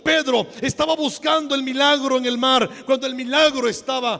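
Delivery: loud, high, fervent sermon delivery; impassioned pulpit cry; full-force preaching. Acted. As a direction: proclaim it to the whole congregation, stretching key words.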